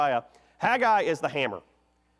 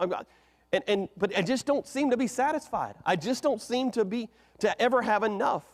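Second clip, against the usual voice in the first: higher, whiny voice of a never-content person; restless complainer; 6 semitones higher than usual. Retold or imitated. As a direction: play someone who can't get enough. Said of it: "we could say it like this".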